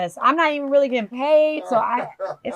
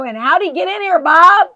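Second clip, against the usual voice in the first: higher, louder yell for Bob; 7 semitones higher, 7 dB louder than usual. Real, not acted. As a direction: call out for help, loud. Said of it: "Why are you yelling in my ear".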